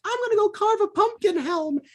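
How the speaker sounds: put-on high-pitched little-kid voice. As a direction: pipe it up high like a child running by. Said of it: "this little kid's running by".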